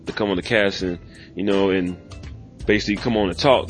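ordinary talk

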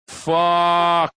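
one long, high, held curse; mock dismay. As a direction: draw it out in hammed-up defeat. Acts out his losing reaction.

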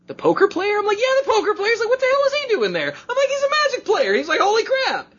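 very high, squeaky back-and-forth; hammed-up astonished squeal; excited retold exchange. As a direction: act out both sides in a worked-up high voice. Acted excited phone call, both sides.

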